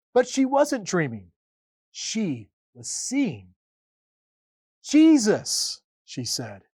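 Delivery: expressive read-aloud storytelling; story narration. A narrator's reading. Pastor reading the Easter story to families. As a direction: tell it warmly, like to children.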